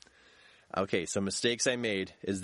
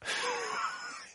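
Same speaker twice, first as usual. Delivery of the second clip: high breathy laugh at his own story; wheezing laugh; 5 dB quieter than usual. Real, not acted. Speaker laughing at his own blunder, squeaky laugh.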